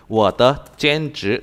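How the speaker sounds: very slow, word-by-word Chinese; slow dictation. Shown as a demonstration. Teacher dictating a sentence for the student to type, slowly. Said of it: "giờ em gõ câu này vào đi".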